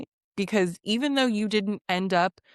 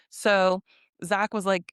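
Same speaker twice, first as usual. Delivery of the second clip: usual speaking voice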